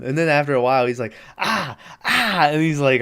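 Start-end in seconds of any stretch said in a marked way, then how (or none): 1.4-2.5 high, short yelps of pain; imitated hurt "ah" noises; mock pained cries. Imitating the turkey hurting during the prayer, mock pained cries. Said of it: "hurting and shit".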